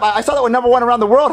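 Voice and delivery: quick, stammered start in an everyday pitch; hurried. Acted. Junior tells the manager what he saw, hurried. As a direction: rattle the news off fast.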